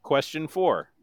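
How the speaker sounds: ordinary delivery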